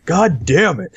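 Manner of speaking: high, put-on exasperated outburst; mock fed-up. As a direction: imitate a game character who has had enough. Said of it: "he gets fed up".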